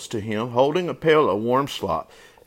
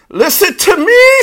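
very high, piercing goose shriek; frantic full-volume squawk; goose screaming; 12 dB louder than usual. A narrator's reading. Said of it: "screamed the goose".

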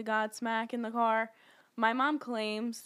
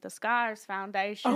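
slow imitation Boston accent, R dropped in Scars; amused Boston-accent impression; slower than usual. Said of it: "in his Boston accent".

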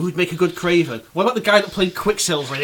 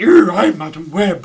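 mock Madam Web; 3 semitones higher, 9 dB louder, slower than usual. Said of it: "She's like".

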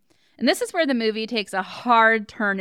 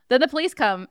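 usual speaking voice

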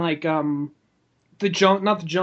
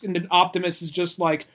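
usual speaking voice

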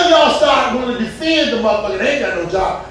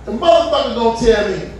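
usual speaking voice

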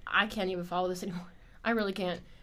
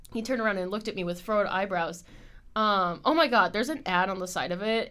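ordinary talk

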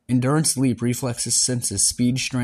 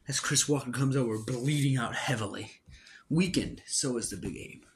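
story narration; 6 dB quieter than usual. Acted. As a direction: tell the fight like a story. Narrates the battle scene.

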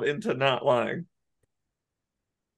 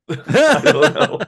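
laughing; 11 semitones higher, 7 dB louder, much slower than usual. Real, not acted. Laughs at own confusion.

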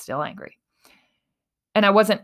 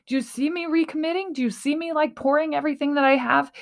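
higher, insistent plea in rising questions; retold distraught pleading; 7 semitones higher than usual. Podcaster re-enacting her plea to the spirits, distraught. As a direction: beg to be noticed, piling question on question. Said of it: "how distraught you're getting".

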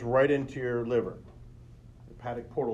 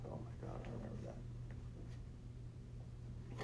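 a slow, breathless whisper; barely voiced, as the memory hits; hushed whisper of sudden remembering; whispered realization; 20 dB quieter, much slower than usual. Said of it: "I was like".

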